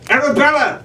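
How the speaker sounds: high, urgent call across the room. A narrator's reading. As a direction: call her name urgently. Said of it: "Jack called out".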